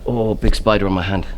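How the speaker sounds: ordinary talk, nothing marked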